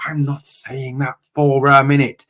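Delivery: ordinary talk, nothing marked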